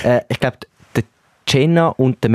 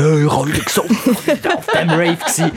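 higher, laughing party boast; mock Monday confession; 5 semitones higher than usual. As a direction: play the hungover raver, giggling. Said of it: "ich komme am Montag". (lachend gespielter Raver)

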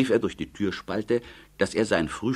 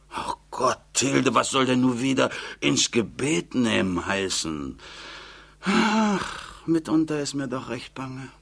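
put-on higher, plaintive old mother's voice; fretful old mother; 7 semitones higher than usual. Old mother pleading with her daughter Tilde, higher and plaintive. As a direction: whine it like a worried old mother.

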